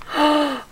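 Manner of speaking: sharp, high intake of breath; astonished gasp. Real, not acted. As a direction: gasp at an amazing gift. Overwhelmed by gifts in parcel.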